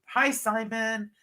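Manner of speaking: ordinary talk, nothing marked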